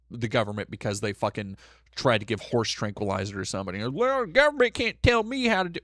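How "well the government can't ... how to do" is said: higher mocking voice of an aggrieved conservative. Streamer mocking conservatives, in a sneering higher voice. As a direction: sneer out their complaint in a put-on voice.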